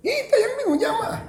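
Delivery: squeaky high laughing words. Real, not acted. Preacher joking to the congregation, laughing high.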